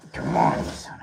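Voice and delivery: whispered curse under the breath; muttered curse. Real, not acted. Searching the floor for the lost Santa hat, whispered curse. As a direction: hiss it to yourself while searching.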